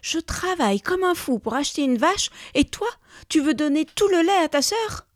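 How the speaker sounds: high, loud outraged complaint; angry yelling. A narrator's reading. Husband rages at his wife about the milk, angry yelling. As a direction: protest at full indignation. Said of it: "se mit à hurler le mari".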